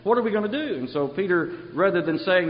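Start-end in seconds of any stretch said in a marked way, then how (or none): 0.0-0.7 higher, fast, mock panic; fast and higher, acting out desperate listeners; mock anguished cry. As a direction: rush it out like a panicked crowd. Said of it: "they cried out".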